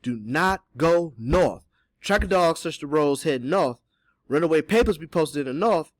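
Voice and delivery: deliberate dialect character warning; insistent repeat. A narrator's reading. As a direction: hammer the warning home firmly. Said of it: "Cato repeated".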